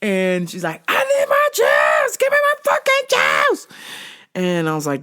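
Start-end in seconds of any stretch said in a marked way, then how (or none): 0.9-3.7 screaming demand. Retold or imitated. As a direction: yell at the juice bar clerk. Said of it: "screaming at the juice bar".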